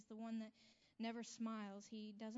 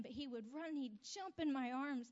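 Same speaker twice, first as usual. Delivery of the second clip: ordinary talk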